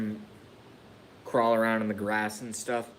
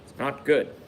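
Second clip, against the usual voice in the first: exasperated; 5 semitones higher, 5 dB quieter than usual. Real, not acted. Frustrated at own tears.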